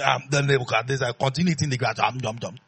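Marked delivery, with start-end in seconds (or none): none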